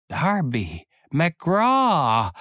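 high, drawn-out wailing name; ghostly whining. Acted. Ghostly voice wails a name at the frightened pirates. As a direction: wail it like a lost soul from afar. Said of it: "winselte es".

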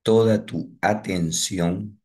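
ordinary talk, nothing marked

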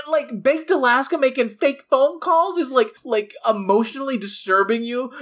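high-pitched, mocking questions that climb; incredulous disbelief. Podcaster challenging people scared by silly rioters, incredulous. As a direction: scoff in exaggerated disbelief at their fear.

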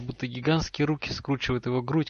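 ordinary delivery, nothing marked

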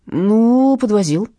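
usual speaking voice

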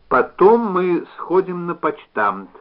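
slow, high, a bit challenging; a touch of defiance; defiant. Acted. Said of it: "немножко с вызовом сказала".

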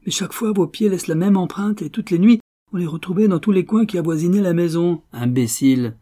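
high, loud scornful tirade; acted irate berating. A narrator's reading. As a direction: berate the fool you have caught. Millionaire berates captured burglars.

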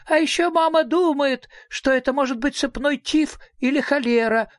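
put-on high, doleful child's voice; small girl predicting doom; gloomy little girl. A narrator's reading. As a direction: complain gloomily in a tiny high voice. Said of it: "мрачно сказала дочь Мимлы".

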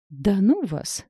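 mildly snappy brush-off; snappish retort. A narrator's reading. Mashka brushes off her gossiping colleagues, mildly snappish. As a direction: fend the teasing off with a touch of irritation. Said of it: "огрызнулась Маша".

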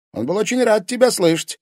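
high, sneering jab at her; mock her with a sneer; sarcastic. A narrator's reading. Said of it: "язвительно сказал Тимофей".

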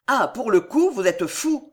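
mild exclamation; exclaiming. A narrator's reading. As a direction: blurt it out, shocked. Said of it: "s'écrie Jeannette".

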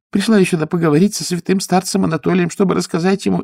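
high, loud flustered gabble; pilgrim woman eager to please, high and loud; flustered chatter. Acted. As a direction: rattle it off anxiously. Woman voiced higher.

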